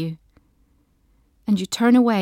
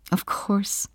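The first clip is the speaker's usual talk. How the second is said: whispered; 5 dB quieter than usual. A narrator's reading. Narrator whispers emotional aside.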